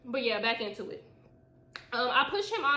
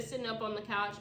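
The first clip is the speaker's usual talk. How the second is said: usual speaking voice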